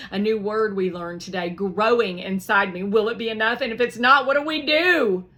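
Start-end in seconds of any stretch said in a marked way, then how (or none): 2.8-5.3 building louder and higher; rising worry. Acted. Piper waiting on the final count, rising worry. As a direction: let the worry climb.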